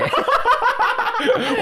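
high squealing laugh; big laugh. Real, not acted. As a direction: burst out laughing. Laughing at 敷衍教学. (大笑)

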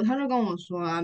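normal talking voice

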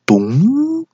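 high comic plop noise imitated with the mouth; mock plop. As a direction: pop out a squeaky plop. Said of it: "那个咚是便便跳水的声音". (模仿便便落水的高音咚声)

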